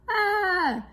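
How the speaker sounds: high startled "ah" cry; a yelp as he's flung through the air. A narrator's reading. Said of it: "crie Eric".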